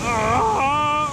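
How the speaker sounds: sharp high gasp at icy water; cold gasp. Real, not acted. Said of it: "elle est glacée".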